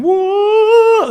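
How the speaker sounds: high, loud hammed-up whoa; mock flamboyant whoa. Preacher mocking a flamboyant healing show, hammed up. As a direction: ham up a showy healer.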